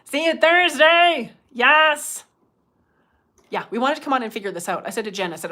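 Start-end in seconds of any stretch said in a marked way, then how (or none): 0.0-2.2 loud, high, drawn-out holler; calling out. Real, not acted. Host calls goodbye to someone until Thursday, hollering. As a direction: call it out across the room.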